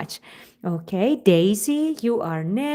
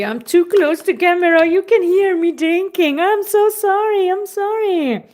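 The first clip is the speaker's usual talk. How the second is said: flustered loud apology; 7 semitones higher, 7 dB louder than usual. Real, not acted. Flustered apology after loud drinking.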